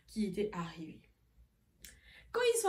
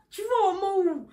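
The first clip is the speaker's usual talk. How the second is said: mock quote; 10 semitones higher, 10 dB louder than usual. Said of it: "Ma grande sœur me dit".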